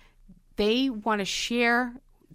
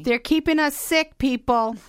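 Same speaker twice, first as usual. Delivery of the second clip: raised, higher-pitched outburst at the food industry; indignant outcry; 5 semitones higher, 4 dB louder than usual. Real, not acted. Host railing against processed food, loud indignant outcry. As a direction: call it out loudly to the listeners.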